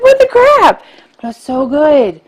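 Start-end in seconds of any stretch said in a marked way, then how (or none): 0.0-0.8 high disbelieving cry; shocked. Retold or imitated. Recalling a shock death in Scream, high and incredulous. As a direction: squeal it in mock outrage. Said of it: "was like".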